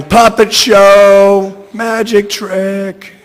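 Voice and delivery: high, slow, loud shout fading at the end. A narrator's reading. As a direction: shout it drawn out, laughing off.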